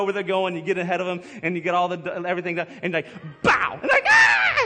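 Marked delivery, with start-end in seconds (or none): 2.8-4.6 sudden shouted boo, leaping high; mock jump scare. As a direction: jump out and yell to scare the kid. Dad startling kids.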